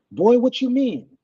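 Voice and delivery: high, sharp, startled mother asking back; a mother caught off guard, voice jumping up; voiced mother asking her son in alarm.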